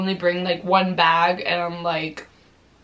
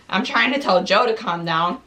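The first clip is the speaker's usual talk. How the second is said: ordinary delivery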